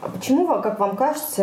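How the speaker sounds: normal talking voice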